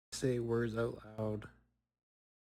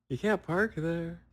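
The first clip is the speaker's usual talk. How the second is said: louder, higher, drawn-out mock shout; retold yell; 5 semitones higher, 5 dB louder, slower than usual. Dreamer at a badly parked car, retold yell. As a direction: call it out slowly, imitating a dream yell. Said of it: "I got to yell".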